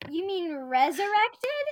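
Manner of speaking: high, loud, slow disbelief; incredulous. Real, not acted. Asks about New Erection, loud and incredulous. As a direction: ask it as if it can't be true.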